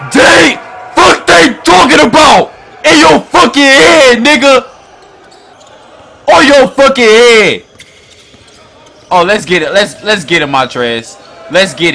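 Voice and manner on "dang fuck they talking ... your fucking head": loud high excited shout at the play; hype yelling. Real, not acted. Gamer yelling at a huge dunk on screen, hyped shout. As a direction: yell it like you just saw a poster dunk.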